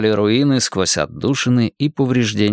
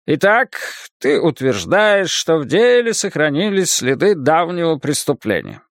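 higher-pitched, well-acted nonchalance; feigned indifference; 5 semitones higher than usual. A narrator's reading. Librarian checking the boys' claim, feigned indifference. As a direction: drag it out as if you barely care. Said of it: "с хорошо разыгранным равнодушием".

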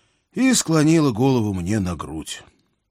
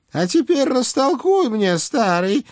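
put-on high nagging old hag; old witch voice; 4 dB louder than usual. A narrator's reading. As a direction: scold like a fussy old witch. Baba Yaga voiced by reader.

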